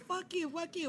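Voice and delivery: high, hushed imitation of yelling; soft high-pitched mock yell; mock cursing. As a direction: mimic repeated cursing in a tiny high voice. Mimics her friend's repeated cursing.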